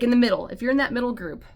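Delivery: ordinary talk, nothing marked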